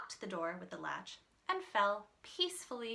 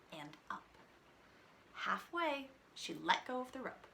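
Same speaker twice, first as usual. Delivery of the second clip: whisper; 3 semitones higher, 5 dB quieter than usual. A narrator's reading. Narrator whispers.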